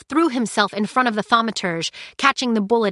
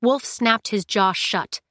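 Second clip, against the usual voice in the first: plain reading voice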